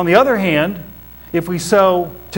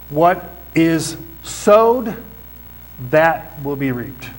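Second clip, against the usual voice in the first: slow solemn statement; 2 semitones lower, much slower than usual. Acted. Preacher states the principle.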